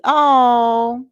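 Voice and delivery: loud, high, drawn-out oh of delight; real thrilled exclamation. Real, not acted. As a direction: let out a big touched "ohhh". Moved by viewer offering to meet.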